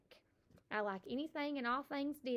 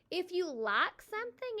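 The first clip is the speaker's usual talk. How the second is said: ordinary talk, nothing marked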